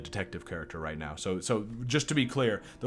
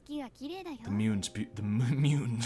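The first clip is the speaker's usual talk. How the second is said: mock anime girl voice; 2 semitones higher, 4 dB quieter, much slower than usual. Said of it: "making fun of their voices".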